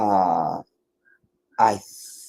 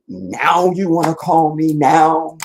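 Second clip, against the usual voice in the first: mock annoyed; 5 semitones higher, 5 dB louder, slower than usual. Retold complaint to calling nephew.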